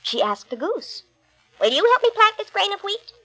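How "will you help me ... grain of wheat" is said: high eager hen voice; little red hen. Acted. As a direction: ask brightly as the little hen. Hen's request again.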